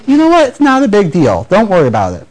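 starts very high and breezy, then settles; the way not to answer an apology; wrong, dismissive way. Shown as a demonstration. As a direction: shrug the sin off cheerily. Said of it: "don't say".

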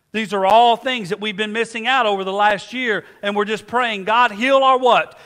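loud, fervent preaching build; preaching at full force. Acted. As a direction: cry out the prayer with rising passion.

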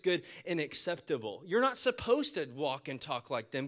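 loud, driving preacher delivery; full-force preaching. Acted. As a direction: preach it out to the congregation. Preaching Romans twelve at full force.

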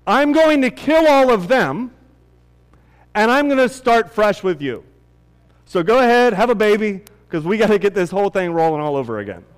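loud, high, exasperated God; God's voice. Retold or imitated. God tells Moses he will start over, loud and exasperated. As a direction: play an angry God ready to start over. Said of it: "God comes to Moses and he's like".

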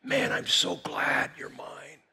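slow, hushed near-whisper; intimate tenderness. Acted. The Lord leaning in to his child, intimately tender. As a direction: lean in close and whisper it lovingly. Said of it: "lean into you and say".

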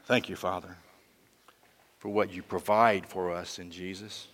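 hushed pastoral prayer; closing prayer. Acted.

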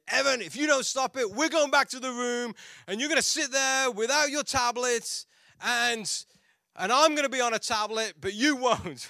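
high, loud parental threat; scolding mother. Retold or imitated. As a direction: imitate a wife laying down the law. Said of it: "full of empathy".

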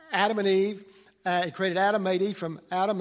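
usual speaking voice

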